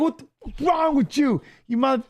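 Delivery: mild mock rage at the driver who rear-ended him; retold angry yell. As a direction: yell at the guy who hit you, reliving it. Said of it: "and I was all pissed".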